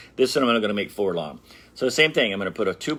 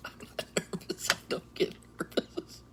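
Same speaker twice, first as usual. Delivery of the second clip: mock whisper; 9 semitones higher, 13 dB quieter than usual. Telling viewers he is not nervous, a whispered fib. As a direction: hush it like a secret fib.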